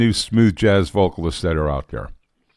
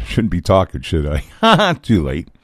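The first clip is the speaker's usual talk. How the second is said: ordinary delivery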